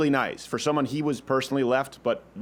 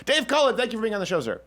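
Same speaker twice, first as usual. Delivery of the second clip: excited intro; 8 semitones higher than usual. Real, not acted. Host grandly introduces guest.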